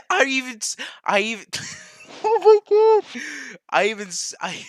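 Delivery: high, loud words broken by laughter; helpless laughing. Real, not acted. Streamer floored by his own mistake, helpless laughing disbelief. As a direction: laugh too hard to finish the sentence.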